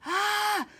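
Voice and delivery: high startled scream. A narrator's reading. Boys seeing the mud monster, screaming. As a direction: shriek in sudden terror. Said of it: "they screamed".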